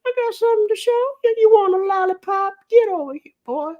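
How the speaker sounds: put-on high, wheezy creepy old neighbor; creepy old man voice. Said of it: "that was the old man from Family Guy".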